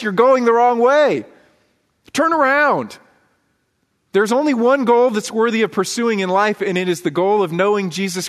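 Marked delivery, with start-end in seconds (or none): 0.0-3.0 high, urgent cry of warning; heavenly host screaming. Acted. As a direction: call out hard like heaven's onlookers. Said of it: "they are screaming at us".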